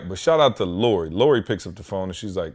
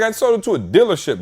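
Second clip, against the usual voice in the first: normal talking voice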